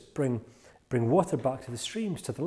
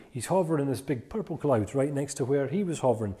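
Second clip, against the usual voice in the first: ordinary talk, nothing marked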